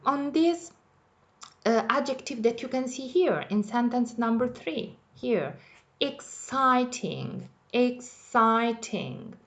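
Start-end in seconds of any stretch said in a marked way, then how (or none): 6.0-9.4 stretched out slowly, falling at the end; slow model word. Shown as a demonstration. Teacher modelling exciting slowly for her class. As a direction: say exciting slowly so the class can hear each syllable. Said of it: "Is it a long one?".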